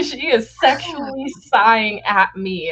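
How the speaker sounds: mock outraged patron; mock complaining patron. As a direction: lay on the indignation. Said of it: "He was like".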